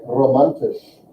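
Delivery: "romantisch" with a front-trilled, Spanish-type R; Austrian trilled R. Shown as a demonstration. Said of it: "They trill it on the front".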